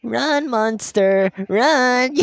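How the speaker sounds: high, slow cackling villain; villainous taunt. Acted. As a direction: gloat with a cackle.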